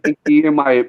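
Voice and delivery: ordinary talk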